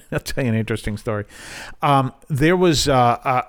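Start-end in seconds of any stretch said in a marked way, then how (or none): 0.1-1.2 quick, soft chuckled aside; tossed off quietly with a laugh; amused aside. Real, not acted. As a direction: say it low and fast through a chuckle. Chuckling repeat of own announcement.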